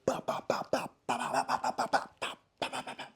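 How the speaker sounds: loud rapid ba-ba punch sounds; imitated flurry of hits; punch noises. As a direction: rattle off blows like a cartoon beating.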